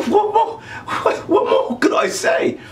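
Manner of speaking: high-pitched, laughing protest; laughing bewilderment. Retold or imitated. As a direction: squeak it out helplessly through laughter. Baffled reply retold while laughing.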